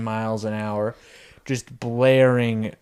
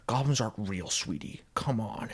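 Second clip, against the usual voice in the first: just saying it; 7 dB quieter than usual. Retold or imitated. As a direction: dismiss it gently and quietly. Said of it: "he just says it".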